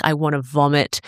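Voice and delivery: usual speaking voice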